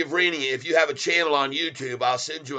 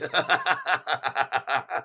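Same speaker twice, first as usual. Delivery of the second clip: long rattling chuckle; hearty laugh; 4 dB quieter than usual. Real, not acted. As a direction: crack up at the joke. Laughs at a viewer's comment.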